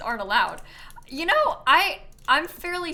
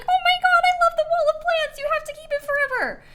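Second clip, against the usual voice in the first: high, loud gushing fan squeal; mock over-excited fans losing it, very high-pitched; mean viewer impression; 9 dB louder, faster than usual. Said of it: "sorry for my mean impression of you".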